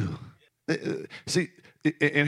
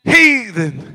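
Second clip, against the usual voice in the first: loud, high, slow outcry; real passion, near shouting; passionate preaching; 10 semitones higher, 10 dB louder, slower than usual. Real, not acted. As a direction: pour it out on the congregation. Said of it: "am I sounding passionate? I'm not screaming".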